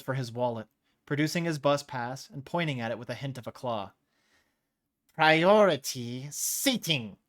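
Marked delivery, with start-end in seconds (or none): 5.1-7.2 slow, louder sputtering businessman; sputtered. Acted. The businessman showing his pass, slow sputter. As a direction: sputter it out indignantly. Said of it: "he sputtered".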